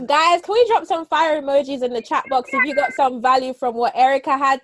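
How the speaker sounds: loud, high, last word stretched out; rally the crowd at full volume; excited hype. Real, not acted. Host asking the chat to cheer the speaker, excited hype.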